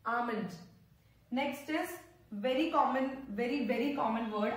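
ordinary talk

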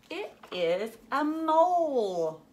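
high, slow, delighted reveal; excited reveal. Acted. Teacher revealing the answer to kids, high and thrilled. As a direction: stretch it out like big news.

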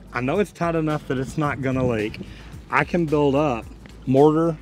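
ordinary delivery

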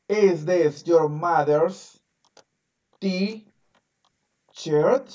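very slow Is this your mother's t-shirt; teacher models the question slowly. Shown as a demonstration. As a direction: drill each word slowly. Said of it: "Repite después de mí".

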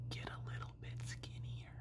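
hushed, voiceless whisper; whispered aside. Acted. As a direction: slip it in as a secret whisper. Whispers number six as an aside.

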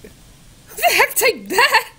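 high, slow, stunned "who the heck"; can't believe what the bot wrote; shocked disbelief. Real, not acted. Reacting to the chatbot's reply, high shocked disbelief.

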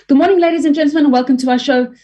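bright high show welcome; high greeting. Shown as a demonstration. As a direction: lift the whole welcome up high. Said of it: "a different tone".

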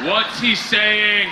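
very slow, drawn-out question; insistent repeat. Acted. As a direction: spell it out slowly, insistently.

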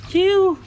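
high squeaky whoosh for the cast; mouth sound effect. Acted. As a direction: zip the line out with a high "chiu". Toy fishing, casting sound. (tiếng vút cao)

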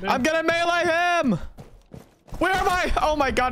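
high, loud frantic yelling; hyped gamer bro. Real, not acted. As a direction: yell it mid-fight, all adrenaline. Panicked hyped yelling in a fight.

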